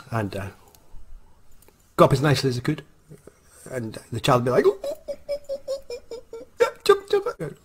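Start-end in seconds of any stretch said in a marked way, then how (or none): 4.7-7.6 put-on excited kid. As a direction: coo over the chocolate like a delighted toddler. Excited child gets chocolate.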